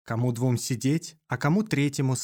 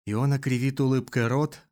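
ordinary delivery, nothing marked

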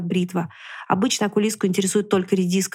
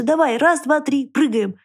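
бодрый счёт; 5 semitones higher, slower than usual. A narrator's reading. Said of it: "уговаривал лис".

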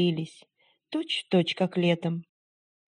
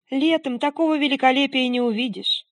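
lightly voiced happy girl; 6 semitones higher than usual. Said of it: "вся сияя от удовольствия".

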